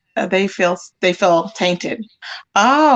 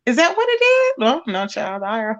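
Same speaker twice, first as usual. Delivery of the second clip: usual speaking voice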